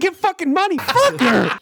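high-pitched frustrated yell; real yelling. Real, not acted. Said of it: "Sorry, I'm yelling".

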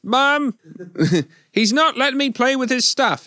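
put-on whiny kid voice. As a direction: play a grown man whining to mom like a kid. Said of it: "he says".

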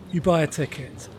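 ordinary talk, nothing marked

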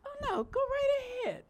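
high, sugary yielding voice; sweetly kind. Retold or imitated. Churchgoer letting a line-cutter go ahead, sugary high. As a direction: overdo the politeness. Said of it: "Be kind".

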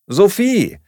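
high, loud call of a name. A narrator's reading. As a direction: call after your flatmate. Margarete runs after her, calling. (laut und hoch gerufen)

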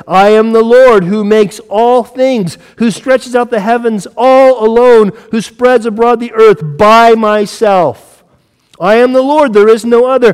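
loud, high, slow and insistent; forceful scripture proclamation. Acted. Preacher reading the Lord's exclusive statements, forceful proclamation. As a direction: declare each clause at full preaching force. Reads God's words through Isaiah.

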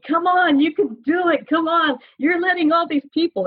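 put-on high-pitched kid cheering someone on; encouraging kid. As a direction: imitate a child urging you uphill. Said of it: "he's, like, encouraging me".

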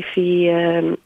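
normal talking voice

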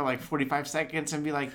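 ordinary talk, nothing marked

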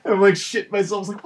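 ordinary talk

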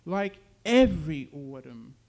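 loud punch on "every"; insist, leaning hard on "every"; emphatic, forceful correction; insistent. A narrator's reading. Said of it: "she insisted".

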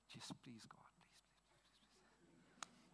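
breathless whispered begging prayer; whispered plea. Retold or imitated. Desperate whispered prayer retold.

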